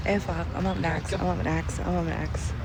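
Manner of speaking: hushed, anxious repeating; soft dread, repeated under the breath; nervous. Real, not acted. Skydiver realizing her turn is next, hushed and nervous. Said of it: "why do I talk this much when I'm nervous".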